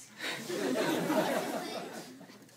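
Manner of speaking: loud theatrical sob. Acted. Orphan begging forgiveness with a dramatic sob. Anne's staged repentance.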